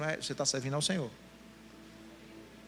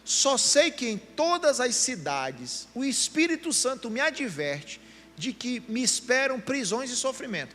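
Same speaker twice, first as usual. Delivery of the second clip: loud, slow and higher reading of the verse; emphatic scripture reading; 6 semitones higher, 4 dB louder, slower than usual. Shown as a demonstration. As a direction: read the verse out loud, every word weighed. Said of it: "olha que bênção". (leitura bíblica lenta, alta e enfática)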